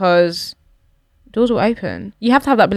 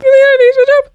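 high, loud panicky squeal; hammed-up alarm; mock panic; 4 dB louder, faster than usual. Mock panic over memes.

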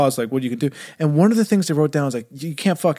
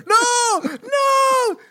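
very high screaming No, no; mock scream. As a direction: scream while being dragged away. Greg dragged by the pig.

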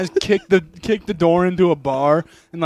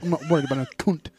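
exaggerated cockney-style British accent, rowdy; mock British accent; 4 dB louder than usual. Friend playing a crude British bloke, mock British accent. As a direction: lean hard on a comic British accent.

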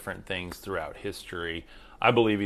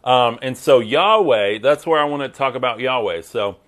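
ordinary talk

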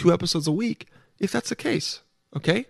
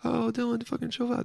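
high, soft teasing listener voice; much higher and softer mock listener; 6 semitones higher, 9 dB quieter than usual. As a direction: play a listener telling Dylan to calm down. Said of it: "that's what you guys are saying".

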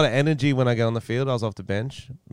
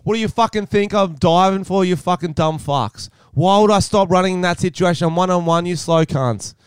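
higher, heated rant at the defenders; angry rant; 8 semitones higher, 5 dB louder than usual. Retold or imitated. Injured player yelling back at defenders, heated mock rage. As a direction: vent the fury at them. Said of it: "I'm so like".

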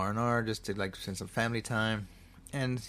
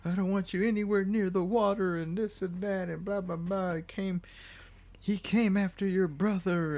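high-pitched frantic mother, imitated; mock mental breakdown; 9 semitones higher than usual. Mother begging son to avoid the water, mock breakdown. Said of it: "like, a mental breakdown".

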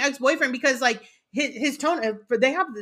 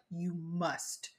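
low, soft, measured plea; imitated calming husband; 6 semitones lower, 5 dB quieter, much slower than usual. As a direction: play a husband quietly talking someone down. Husband calming her as she screams.

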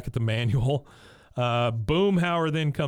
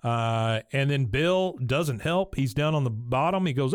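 normal talking voice

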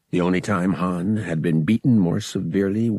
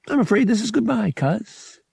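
high, quick, chirpy farewell; cheerful; 14 semitones higher than usual. A narrator's reading. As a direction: gloat cheerfully at a cousin. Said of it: "he said cheerfully".

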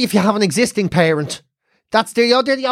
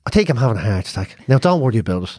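calm; 11 semitones lower than usual. Retold or imitated. Mum voiced calmly.

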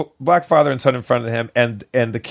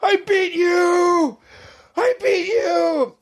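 much higher, slow, breathless victory cry; triumphant gasping cry; much slower than usual. Retold or imitated. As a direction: gloat at the monster between gasps. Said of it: "sludge monster and goes".

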